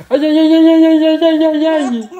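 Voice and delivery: aïe aigus et forts, moitié rire; aïe en riant. Real, not acted. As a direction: plainte exagérée pour rire. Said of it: "croquer les doigts de papa". (le bébé croque ses doigts, aïe forts en riant)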